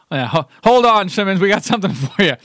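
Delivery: high, hammed-up sketch-character voice; Bill Murray. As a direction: play a comic team captain through laughter.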